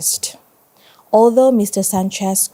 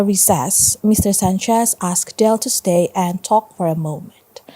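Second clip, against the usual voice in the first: plain reading voice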